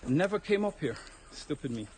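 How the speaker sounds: ordinary talk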